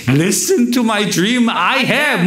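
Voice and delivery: high, very slow, grand pronouncement in English; hammed-up arrogant Joseph; pompous Joseph. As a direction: proclaim it like a self-important dreamer. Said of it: "이런 식으로 막".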